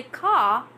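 "car" the British way, with no final R; British silent R. Shown as a demonstration. Teacher tells learners how British people say it, "car" without R. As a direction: end "car" on the vowel. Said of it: "all the British people say car".

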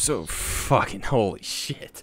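slow, high, loud disbelief; stunned shock. Real, not acted. Streamer reeling from a jumpscare, slow shocked cry. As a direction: drag the words out in shock. Reacting to a scare.

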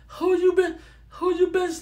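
loud, high mock mama shriek; mother confronting daughter. Mama confronting her daughter over the father, shrill demand.

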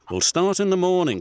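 brisk driver's plan. A narrator's reading. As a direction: driver telling Bulgy the schedule. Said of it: "said his driver".

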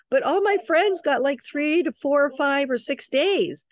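high-pitched retold objection; imitated protest. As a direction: voice a new mother's complaint, pitched well up. Retold daughter-in-law's protest.

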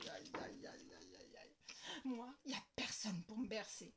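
soft, whispered self-pity; girl whimpering alone in her room; whispered complaint.

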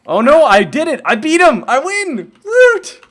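high, loud victory cheer; excited shout. Real, not acted. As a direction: yell it like you just won. Celebrating winning the battle.

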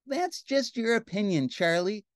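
high, sulky princess voice; pouting princess. Acted. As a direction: brush him off with a pout. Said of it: "said the princess with a pout".

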